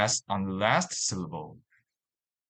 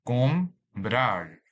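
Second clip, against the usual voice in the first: "Comprar" split in two, com, prar; syllable by syllable. Shown as a demonstration. Teacher dividing a word for learners, "Comprar" in separate syllables. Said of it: "This word has two syllables".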